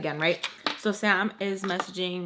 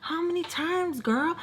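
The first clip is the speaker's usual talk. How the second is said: high exasperated self-scold, much higher; scolding herself; 8 semitones higher, 4 dB quieter than usual. Retold or imitated. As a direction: roll your eyes at yourself. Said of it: "where I'm like".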